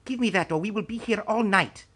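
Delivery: put-on high, thin elderly master's voice; a wizened old master snapping for the phone; old Korean master, impatient. A narrator's reading.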